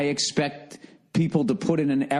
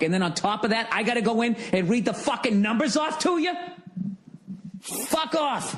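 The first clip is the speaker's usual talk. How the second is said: angry outburst; 10 semitones higher than usual. Acted. Customer venting alone at the bank guy, angry outburst. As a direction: rant climbing much higher to an incredulous yell.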